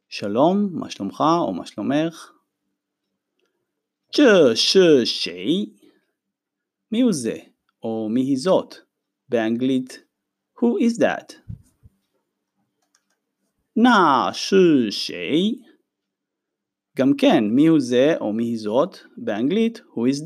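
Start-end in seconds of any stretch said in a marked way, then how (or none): 4.1-5.7 ז'ה שי שי spoken slowly as a careful question; slow Chinese sentence demo. Shown as a demonstration. Teacher modeling a sentence for students, slow. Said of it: "משפטים חדשים". 13.7-15.6 ז'ה שי שי spoken slowly as a careful question; slow Chinese sentence demo. Shown as a demonstration. Teacher modeling a sentence for students, slow. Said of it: "משפטים חדשים".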